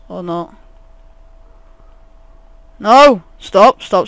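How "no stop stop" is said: urgent, raised outburst; panicked shout. Real, not acted. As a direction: shout it in alarm. Panics under attack in game.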